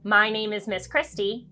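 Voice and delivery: ordinary delivery, nothing marked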